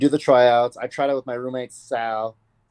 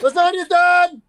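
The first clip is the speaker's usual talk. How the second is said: higher, louder yelled call; mock shout; 9 dB louder, slower than usual. Repeated call that the lasagna is done, shouted higher. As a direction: bellow it across the house.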